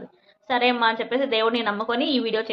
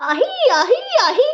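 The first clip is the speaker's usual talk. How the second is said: high, loud trance cries, imitating a possessed woman; possession cries; 12 semitones higher, 6 dB louder, slower than usual. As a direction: mimic the shrill ahi calls of a woman in trance. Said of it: "సీగలు ఇట్లా పెట్టుకొనేసి".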